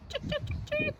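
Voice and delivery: very high, soft chirping; small high bird peeps; sparrow chirps. Acted. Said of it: "The sparrow makes a simple jingle".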